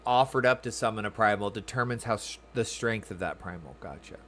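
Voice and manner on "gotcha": ordinary talk, nothing marked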